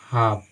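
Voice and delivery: हाफ with no L sound; long vowel, then F, L silent in हाफ; correct pronunciation. Shown as a demonstration. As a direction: model हाफ as "haaf". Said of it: "हम इसको पढ़ेंगे".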